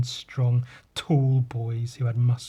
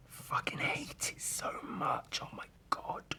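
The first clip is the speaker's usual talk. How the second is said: very soft, slow whisper of loathing; exasperated whisper; 13 dB quieter, slower than usual. Real, not acted. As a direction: whisper it as if worn out by hating it. Venting loathing after the lad impression.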